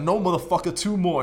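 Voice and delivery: loud, high spotter's yell; yelled gym encouragement. Retold or imitated. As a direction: bark him through the last reps. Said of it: "I was like".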